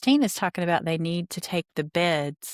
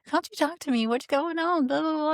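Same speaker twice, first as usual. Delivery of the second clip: high, mock-nagging mom voice, much higher; pushy mom imitation; 10 semitones higher than usual. Imitating herself pestering her sulking daughter, high and mock-nagging. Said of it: "be in her face and be like".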